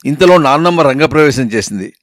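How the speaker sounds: ordinary delivery, nothing marked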